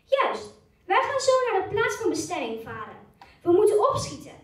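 eager Braak voice. Acted. Braak's lines read in role.

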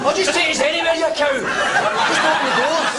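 mock high voice. Man to the lift, in a high mocking squeak.

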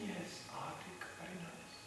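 very soft, self-satisfied murmur; hushed mock approval; mock contentment. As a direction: imitate someone placidly admiring, barely audible. Mimics devotees sitting contentedly in castles.

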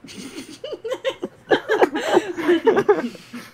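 long, loud high-pitched laugh; big laugh. Real, not acted. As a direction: burst out laughing and keep going. Laughing at the hashtag coughing quip.

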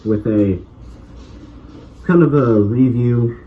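muffled behind mask; speaking inside sealed respirator; through a gas mask. Real, not acted. Seen 0: Full gas mask with hose covers face throughout the labelled words.

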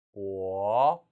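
보 rising from low; second tone. Shown as a demonstration. Teacher calling 이성, 보 with a rising tone. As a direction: start 보 low and glide up. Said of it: "이성".

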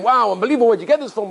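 imitated amazed delight; mildly excited, imagined reaction; happy excitement. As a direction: act pleasantly astonished. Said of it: "he'll be so happy and so excited".

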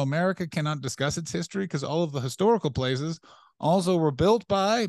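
ordinary talk, nothing marked

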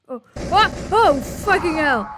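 loud, high scared curse; real fright. Real, not acted. Gamer jump-scared by a creature, loud frightened swearing. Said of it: "That scared the shit out of me".

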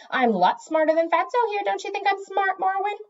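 high, eager bragging; boastful eager cat. A narrator's reading. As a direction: boast in a high voice, seeking approval. Eager cat boasts, seeks approval.

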